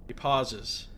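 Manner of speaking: usual speaking voice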